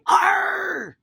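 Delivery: loud high pirate shout; hammed-up pirate call for children; pirate Arr. Shown as a demonstration. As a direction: show the kids how a pirate roars. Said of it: "You gotta say".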